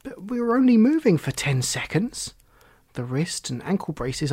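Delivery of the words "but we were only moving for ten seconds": put-on high, louder, disbelieving boy; astounded. Boy learns the journey is already over, astounded. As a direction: protest in amazement, pitch jumping up. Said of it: "Oliver was astounded".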